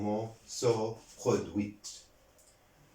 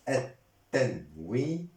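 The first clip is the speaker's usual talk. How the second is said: syllable by syllable. Shown as a demonstration. Teacher dictating a grammar term to learners, syllable by syllable. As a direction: sound out "التنوين" piece by piece, final -nu voiced.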